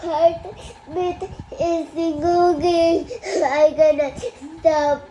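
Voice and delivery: loud, high, halting sobs; crying. Real, not acted. Child explains to Papa, crying hard. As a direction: struggle to talk through real crying.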